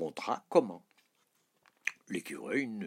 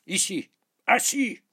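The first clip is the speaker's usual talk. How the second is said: high, loud orders to a dog; shouted commands; 10 semitones higher, 9 dB louder than usual. A narrator's reading. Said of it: "s'écria le roi".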